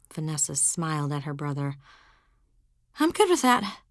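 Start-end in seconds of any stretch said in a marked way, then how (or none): 3.0-3.8 higher, louder fake brightness; covering with false cheer; forced cheer.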